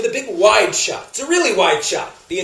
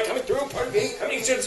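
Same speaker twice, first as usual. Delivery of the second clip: softer, hushed cinema apology; apologetic squeezing past; 3 semitones higher, 10 dB quieter than usual. Acted. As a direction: excuse yourself softly along a full row. Squeezing past seated cinema audience.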